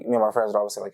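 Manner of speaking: usual speaking voice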